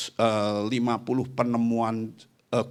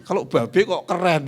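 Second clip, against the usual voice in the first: higher, louder retort from the wife; wife's voice; 7 semitones higher, 7 dB louder than usual. Retold or imitated. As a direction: mimic the wife's protest. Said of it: "Nyonya saya ngomong gini". (istri membantah dengan nada tinggi)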